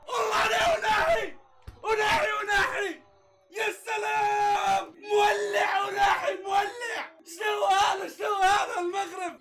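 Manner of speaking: ecstatic high shouting, stretched cries; goal celebration. Real, not acted. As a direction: scream it out like your team just scored. Moroccan player scores, celebration.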